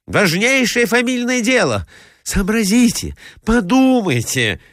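pleading nephew. A narrator's reading. Nephew begging his uncle to consider a family matter, urgent and pleading. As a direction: plead with the old man to think.

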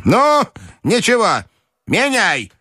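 high, resolute command; determined old lady; decisive grandmother's cry. A narrator's reading. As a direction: order it firmly in a high voice. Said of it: "крикнула она решительно".

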